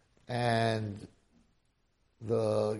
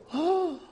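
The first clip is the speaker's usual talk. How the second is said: gasp of relief. Acted. Mother sees the mohel arrive.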